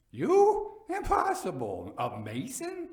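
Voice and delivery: high, loud disbelieving cry; incredulous shock. A narrator's reading. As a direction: scoff in disbelief. Fortunato incredulous.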